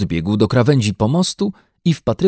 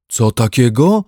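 loud, puzzled little bear call; 3 semitones higher, 6 dB louder than usual. A narrator's reading. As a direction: call back from the water. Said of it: "zawołał Paddington". (wołanie misia)

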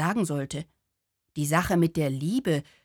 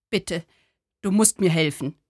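a lightly voiced downcast man asking for help; pleading sad man. To the little Buddha, a sad man's mild plea.

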